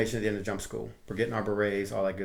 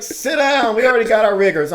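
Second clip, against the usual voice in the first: much higher, loud curt order; loud dismissal; 11 semitones higher, 12 dB louder than usual. Retold or imitated. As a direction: shut him down with a loud order. Staff sergeant dismisses recruit.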